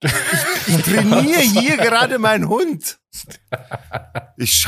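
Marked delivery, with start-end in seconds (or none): 0.0-3.0 high, slow mock outrage; hammed-up indignation. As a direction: repeat it in a pitched-up affronted voice. Repeats owner's absurd line for effect.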